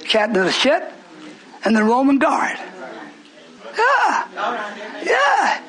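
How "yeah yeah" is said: high, fast shouted preaching; rising preacher's cry. Acted. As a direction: drive it home from the pulpit.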